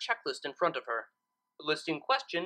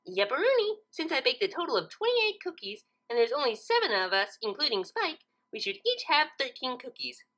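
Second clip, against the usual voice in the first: high, cheerful pony voice; confidently wrong; Pinkie voice; 6 semitones higher than usual. Acted. Pinkie cheerfully tells Twilight her wrong sums.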